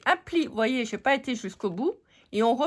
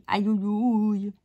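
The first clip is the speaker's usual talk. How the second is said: mock ouch; much slower than usual. Mock pain after truism.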